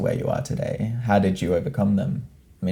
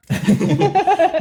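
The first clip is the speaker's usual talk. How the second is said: bright laugh; 6 dB louder than usual. Real, not acted. Interviewer laughs at long answer.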